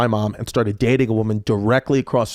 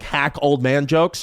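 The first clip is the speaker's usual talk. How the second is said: normal talking voice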